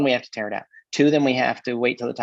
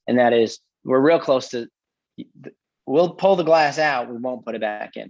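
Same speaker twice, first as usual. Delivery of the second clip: ordinary delivery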